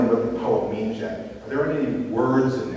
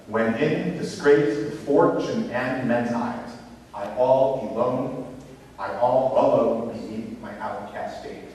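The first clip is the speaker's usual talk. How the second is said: slow, measured beat, "When in disGRACE" with every second syllable stressed; chant-like scansion, pausing between feet, "I ALL aLONE" on the beat; scanned iambic verse; slower than usual. Shown as a demonstration. Teacher demonstrates scanning a sonnet, "When in disgrace" in measured iambs. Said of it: "what we call scanning".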